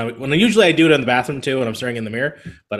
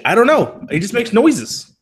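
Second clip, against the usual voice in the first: high, loud, baffled outburst; mock exasperation; 9 semitones higher, 3 dB louder, slower than usual. Man baffled by neighbor's noises, high exasperated outburst. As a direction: throw it out high, giving up. Exasperated end of his puzzling.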